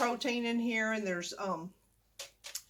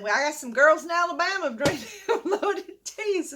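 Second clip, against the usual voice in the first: laughing; 6 semitones higher, 5 dB louder than usual. Real, not acted. Laughing while retelling the story.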